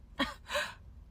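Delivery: quick unvoiced giggle; breathy laugh. Real, not acted. Shopper giggles at her discounts, unvoiced. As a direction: giggle under your breath.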